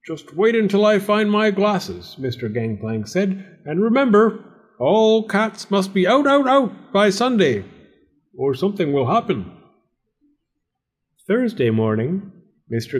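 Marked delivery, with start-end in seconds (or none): 0.1-2.2 high-pitched storybook threat; high, indignant landlord voice; warning landlord. Acted. Suspicious landlord warns tenant. 3.7-9.5 storybook landlord's ringing warning; stern cat-banning landlord. Acted.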